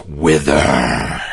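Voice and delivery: very low, dark necromancer voice; deep necromancer casting a curse; low villain cry. Acted. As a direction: growl the spell from deep down. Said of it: "he yells".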